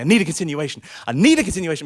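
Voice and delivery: loud, repeated demand; insistent shouting. Acted. Passenger demanding a ticket from driver, loud insistence. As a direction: insist on it with rising volume. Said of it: "We start really shouting at each other".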